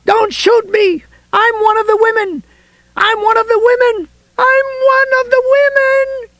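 very high desperate cries, climbing; crying out. A narrator's reading. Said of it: "Helen was crying at the police".

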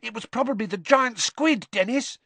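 put-on high-pitched eager boy; thrilled brother sharing a theory; excited. A narrator's reading. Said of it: "just as excitedly".

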